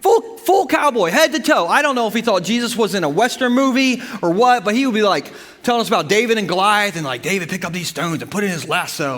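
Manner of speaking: ordinary delivery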